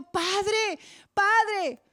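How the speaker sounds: high, rising plea to the Father; a hint of an anguished intercession; pleading cry. Spirit interceding, crying to Father.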